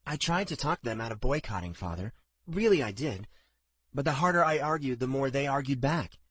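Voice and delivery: a hint of a pleading daughter; earnest daughter. A narrator's reading.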